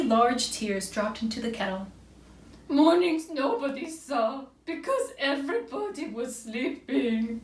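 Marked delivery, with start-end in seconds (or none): 2.7-7.4 high, slow weepy owl voice, fading; mock sobbing. A narrator's reading. Owl sobs into the kettle, acted high sobbing. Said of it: "sobbed Owl".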